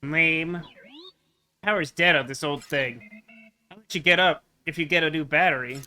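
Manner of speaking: nasal little robot voice; pinched, nasally robot character. Acted. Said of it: "nasally voice to the Stoat".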